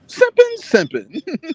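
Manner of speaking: high squeaky comedian voice; Katt Williams voice. Retold or imitated. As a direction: jump way up high and loud on simping. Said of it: "It's my Cat Williams voice right there".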